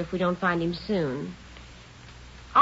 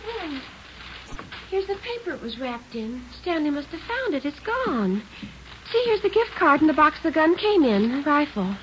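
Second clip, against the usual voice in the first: alarmed discovery; 8 semitones higher, 4 dB quieter than usual. Acted. Discovers the gun missing.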